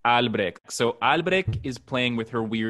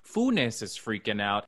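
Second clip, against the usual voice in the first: ordinary talk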